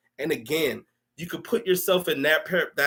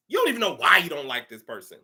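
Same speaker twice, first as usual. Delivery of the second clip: ordinary talk, nothing marked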